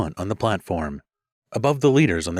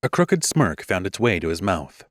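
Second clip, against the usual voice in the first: ordinary delivery, nothing marked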